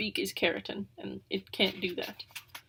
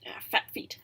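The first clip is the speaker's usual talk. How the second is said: hushed unvoiced whisper. Narrator bird whispering over Pivo's feet. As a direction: breathe the words out knowingly.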